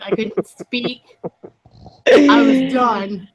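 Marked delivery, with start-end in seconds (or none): 2.1-3.3 breathless with laughter, slow; choking on laughter; laughing hard. Real, not acted. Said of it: "I couldn't breathe".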